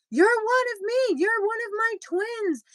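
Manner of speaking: high-pitched tearful cry. Retold or imitated. Retelling her tearful reunion with Ricky, high crying voice. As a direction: squeal it through tears of joy. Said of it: "we just started bawling".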